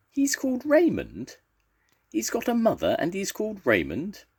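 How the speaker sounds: very high, incredulous questions; astonished. A narrator's reading. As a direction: crowd squeaking in disbelief. Astonished crowd reacts to the name.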